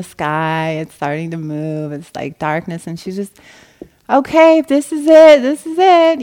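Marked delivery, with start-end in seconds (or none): 4.1-6.2 high, loud rapturous cry of acceptance; ecstatic surrender. Retold or imitated. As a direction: call it out much higher and louder, swept up in release. Facing death, letting go.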